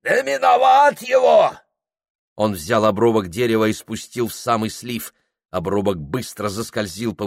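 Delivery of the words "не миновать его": high loud shout. Acted. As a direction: call it out over the roar. Shouting at the rapids. (крик)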